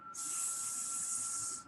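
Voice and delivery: unvoiced hissing s; hissed s sound. Shown as a demonstration. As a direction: hiss the letter s. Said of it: "What sound do you see here?".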